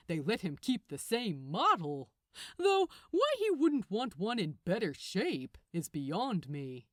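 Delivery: ordinary delivery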